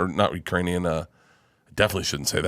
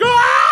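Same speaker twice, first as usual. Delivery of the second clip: sudden high yelp of delight; laughing yell; 7 dB louder than usual. Retold or imitated. Laughing at the screening, he lets out a yelp. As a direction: burst out with a high laughing shout. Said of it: "I'm like".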